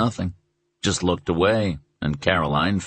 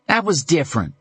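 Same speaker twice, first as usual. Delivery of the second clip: higher, sudden outburst; burst out; 10 semitones higher, faster than usual. Acted. Son bursting out at his mother, heated. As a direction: blurt it out in protest. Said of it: "Tom burst out".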